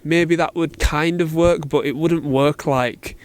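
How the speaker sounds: ordinary talk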